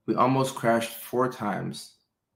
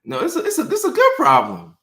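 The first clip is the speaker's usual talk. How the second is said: much higher, giddy squeal; squeaky delight; 6 dB louder than usual. Retold or imitated. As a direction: squeal it like a thrilled kid.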